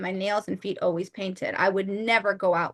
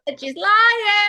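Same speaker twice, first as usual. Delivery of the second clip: teasing call-out; 11 semitones higher, 8 dB louder, slower than usual. Pretend. Teasing friend calls out.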